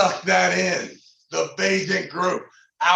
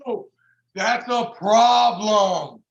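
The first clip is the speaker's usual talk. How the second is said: long ooh, then slow emphatic verdict; hyped awe; slower than usual. Real, not acted. Reacting to Michael Grimaldi being in the house, slow hyped awe. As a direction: stretch it out slowly, relishing the threat. Hyping a feared opponent.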